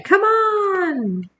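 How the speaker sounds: drawn-out high call to hurry up; yelling. A narrator's reading. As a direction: yell it long and high, like an eager little girl to her daddy. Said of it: "she's yelling at her daddy".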